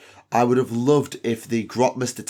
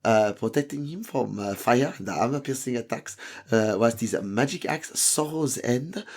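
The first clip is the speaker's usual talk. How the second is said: put-on French accent for a knightly introduction. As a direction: keep up the French accent while reading it out. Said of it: "I will keep up the French accent".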